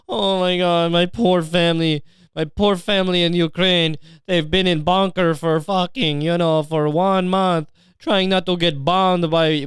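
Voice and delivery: slow, whiny mock victim; mocking woe-is-me voice; playing the victim. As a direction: plead self-pityingly, hammed up. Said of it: "if he played the victim card".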